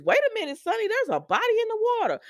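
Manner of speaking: high-pitched, rushed cry of discovery; mock alarm. Dex alerting Sonny to a body, high mock alarm. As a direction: imitate someone suddenly spotting trouble, voice shooting up.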